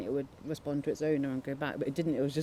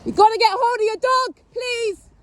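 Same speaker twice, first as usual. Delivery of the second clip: much higher, louder pleading shout; desperate shouting; 9 dB louder than usual. Real, not acted. [name] shouting to the dog owner to grab it, desperate. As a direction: yell it across the field, begging.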